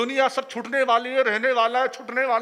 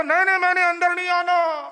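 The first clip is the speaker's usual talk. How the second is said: loud refusal; 7 semitones higher, 5 dB louder than usual. Retold or imitated. Husband refusing to come inside.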